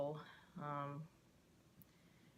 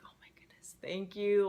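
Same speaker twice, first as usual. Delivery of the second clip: breathless whispered thanks; relieved whisper; 4 semitones higher, 7 dB louder than usual. Retold or imitated. Finally reached on the phone at work, a relieved whisper.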